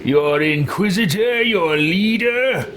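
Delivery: affronted leader in a put-on voice; indignant game hero. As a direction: stretch the words in theatrical indignation. Player voices his character's indignant reply.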